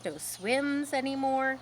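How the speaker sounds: ordinary delivery, nothing marked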